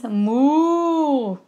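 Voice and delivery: long, drawn-out imitated moo; teasing moo like a cow; mocking cow moo. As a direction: moo slowly to tease the would-be farmer. Imitating cattle after farming talk.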